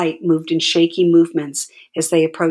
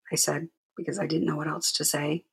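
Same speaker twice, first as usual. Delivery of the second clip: ordinary delivery, nothing marked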